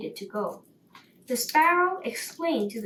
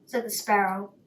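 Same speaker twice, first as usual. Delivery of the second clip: ordinary delivery, nothing marked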